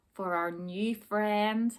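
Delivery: ordinary delivery, nothing marked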